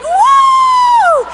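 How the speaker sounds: one long, very high woo; shrieked whoop of delight; excited whoop. Acted. God throws the box open again, excited whooping. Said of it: "He'd get so excited every single time".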